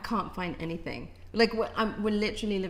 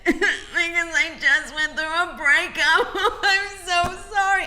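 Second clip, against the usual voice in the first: high, loud, laughing while talking; words spilling through real laughter; 10 semitones higher, 10 dB louder than usual. Real, not acted. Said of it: "I'm trying not to laugh".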